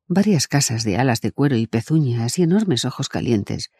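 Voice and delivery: usual speaking voice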